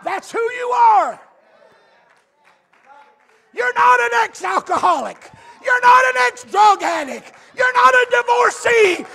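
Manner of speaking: building to a full shout. Real, not acted.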